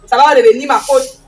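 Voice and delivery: angry scolding. Acted. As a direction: order her to sweep properly. Stepmother scolding girl. (réprimande en colère)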